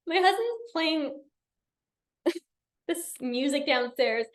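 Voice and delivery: real laughter breaking through the words; laughing. Real, not acted. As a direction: fight back the giggles. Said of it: "I'm just laughing right now".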